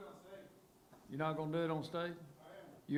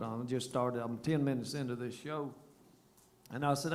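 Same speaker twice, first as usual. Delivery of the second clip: normal talking voice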